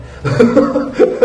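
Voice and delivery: breathy high laugh rising up. Real, not acted. Laughing to the viewers about the road works. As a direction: laugh it off, exasperated. Laughing at his own frustration.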